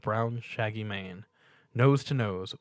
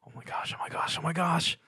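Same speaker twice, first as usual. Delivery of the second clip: whispered, then rising higher; panicked inner whisper; 8 semitones higher than usual. A narrator's reading. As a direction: rush it under your breath, then break out. Stuart's racing mind.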